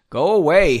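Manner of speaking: yelled. Acted. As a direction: tell them to go away through the door. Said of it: "Henry yelled at the door".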